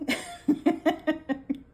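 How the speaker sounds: high, rolling laugh. Real, not acted. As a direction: crack up at your own quote. Amused by own quote.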